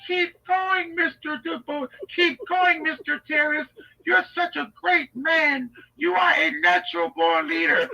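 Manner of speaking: high, gushing flatterer's voice; Jennings lady. Acted. Enabler flattering the accused man, gushing. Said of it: "the Jennings lady".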